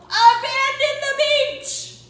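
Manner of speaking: loud, high and slow lifeguard shout; loud order. Acted. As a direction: clear the beach, loud and slow. Lifeguard orders beach cleared.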